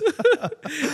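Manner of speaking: high burst of laughter; real high laugh. Real, not acted. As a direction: break up laughing at the bit. Laughing at the joke.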